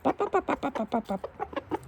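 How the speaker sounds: high popping bop-bop sounds; pleased chicken clucking. Acted. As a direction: cluck like a self-satisfied rooster. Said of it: "That's the chicken sound".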